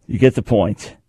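ordinary delivery

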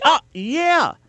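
very high feigned breakthrough; mock realization. Host feigns cracking the basketball riddle, mock realization. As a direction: squeal it like a pupil finally getting it. Pretends to get the obvious answer.

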